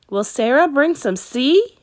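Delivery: put-on small boy's voice, high and eager; young Caleb. A narrator's reading. Caleb asking his sister, high child voice.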